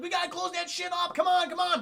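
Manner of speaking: high, pressing retold shout; urgent mock panic. Crew trying to close the helicopter, urgent mock shouting. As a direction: hurry them along in mock alarm.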